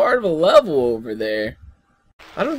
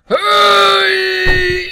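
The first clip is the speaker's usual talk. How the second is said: long, high drawn-out yell; high, stretched holler; protesting cry; 8 semitones higher than usual. Real, not acted. Yelling at the game.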